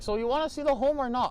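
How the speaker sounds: high, impatient mock voice; salty impression of a curt woman; rude agent. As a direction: demand an answer in a high put-on voice. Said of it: "this lady was extremely rude".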